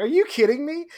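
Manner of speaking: high, loud outraged question; upset disbelief. Retold or imitated. Player reacting to opponent's list, upset and incredulous. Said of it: "I was so upset".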